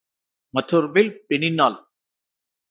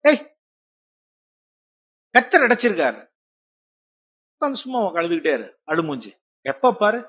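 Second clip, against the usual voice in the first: callous husband; faster than usual. Acted. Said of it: "இதுவே இப்படியும் சொல்லலாம்".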